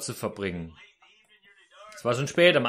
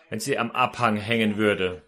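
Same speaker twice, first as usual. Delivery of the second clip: usual speaking voice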